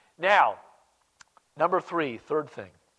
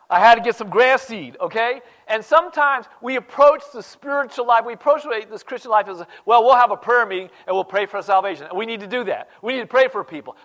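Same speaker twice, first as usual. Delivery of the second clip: rising, louder preaching push; 6 semitones higher, 8 dB louder than usual. Acted. Preacher urging his flock, louder and higher. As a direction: drive the point home to the church.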